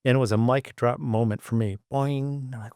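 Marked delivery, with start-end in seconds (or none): 1.9-2.5 cartoon boing. Mic drop moment sound effect.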